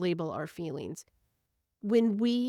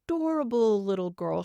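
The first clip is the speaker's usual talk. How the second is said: normal talking voice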